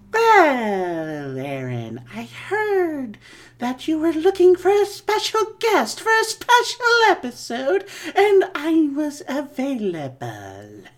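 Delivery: high, creepy horror-host voice; Crypt Keeper voice. Acted. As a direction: ghoulish and pleased with himself. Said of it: "It's your old pal Crypt Keeper here".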